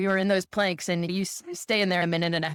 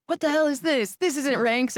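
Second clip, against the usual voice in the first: mock coach yell; 8 semitones higher than usual. Coaches yell at recruits out of ranks, mock outraged bark.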